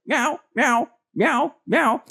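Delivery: high, squeaky cat meows; imitating a cat. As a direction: meow like an annoying cat, way up high. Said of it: "Turtle was just like".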